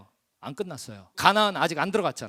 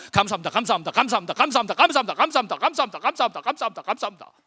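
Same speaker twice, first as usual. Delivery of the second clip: brisk repeated drill at normal volume; rapid-fire thanks; 3 dB louder, much faster than usual. Shown as a demonstration. Preacher demonstrating the first set of ten thanks, rapid repetition. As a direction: rattle off the thanks in one even run. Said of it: "그래서 이렇게 하면 돼요".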